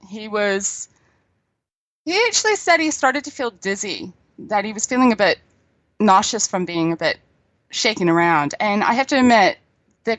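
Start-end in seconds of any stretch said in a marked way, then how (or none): none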